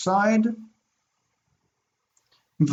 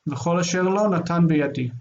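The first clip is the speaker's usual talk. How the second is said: brisk, fast read-through; quick reading; much faster than usual. Real, not acted. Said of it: "I'm just gonna read quickly".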